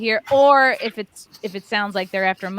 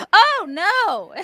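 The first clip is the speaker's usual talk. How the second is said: mock startled cry, higher and louder; 9 semitones higher, 6 dB louder than usual. Retelling turning around at a nude beach, startled. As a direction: act out a startled jolt, pitch jumping up. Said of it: "I was both startled".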